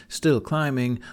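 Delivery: usual speaking voice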